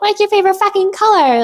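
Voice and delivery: high mocking voice asking a dull question; high put-on voice parodying small talk. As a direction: sneer at the boring question in a high voice. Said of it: "it's not like".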